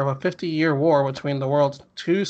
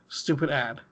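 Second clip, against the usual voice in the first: ordinary talk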